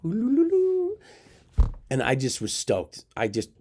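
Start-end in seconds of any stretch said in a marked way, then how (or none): 0.1-1.0 a high sung ooh-loo-loo trill of delight; high joyful trill. Retold or imitated. Teller celebrating the girl's yes with a high trill. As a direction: trill it up high like a giddy kid.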